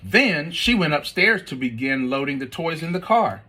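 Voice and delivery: ordinary delivery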